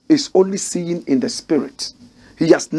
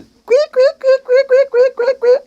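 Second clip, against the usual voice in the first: very high-pitched, loud, repeated squeaks; mock squeaking; 5 dB louder, slower than usual. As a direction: squeak it out in a tiny piercing falsetto. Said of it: "it's like we are squeaking".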